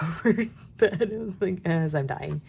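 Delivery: usual speaking voice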